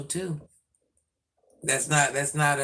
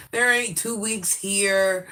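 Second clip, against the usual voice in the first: slow exasperated complaint; 6 semitones higher, 4 dB louder, slower than usual. Real, not acted. Complaining no holidays.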